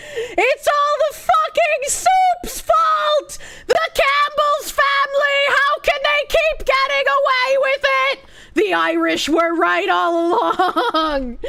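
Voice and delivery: high, drawn-out mock-outraged yelling; hammed-up screechy conspiracy rant; mock ranting scream. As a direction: scream it like an unhinged conspiracy theorist. Mock conspiracy rant about soup.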